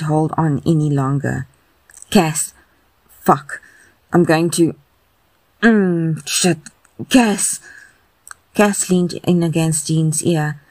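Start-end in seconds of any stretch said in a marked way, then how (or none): 2.1-7.6 crying out at climax. A narrator's reading. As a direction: let the cries break out at the peak. Dean cries out at climax.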